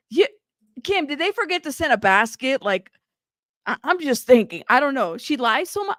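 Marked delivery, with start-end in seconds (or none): none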